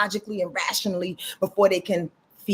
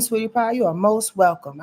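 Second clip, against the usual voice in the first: normal talking voice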